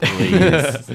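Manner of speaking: high, loud, drawn-out plea; exaggerated pleading. Real, not acted. Co-host begging host not to fart, loud pleading. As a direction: beg with a big rise in pitch and volume.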